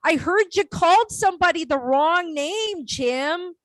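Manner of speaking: sweet Minnesota accent trying to be angry; politely cross in a put-on Minnesota accent; mild Minnesota anger. Shown as a demonstration. Telling Jim off for a wrong name, sweet Minnesota accent. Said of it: "angry in a Minnesota accent".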